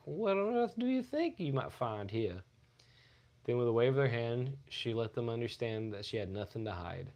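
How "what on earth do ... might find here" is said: haughty. Retold or imitated. Reading the starlet's quote.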